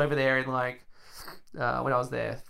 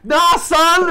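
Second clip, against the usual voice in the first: high, loud "Nah, son!" thrown out as a taunt; mock tough shout; 14 dB louder than usual. Retelling how he'd taunt a kid, high mock shout. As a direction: bark it like a cocky kid shutting someone down. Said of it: "I'll be like".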